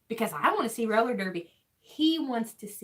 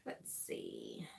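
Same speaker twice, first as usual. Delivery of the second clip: unvoiced whisper to herself; 22 dB quieter than usual. Real, not acted. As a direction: breathe it out in a whisper.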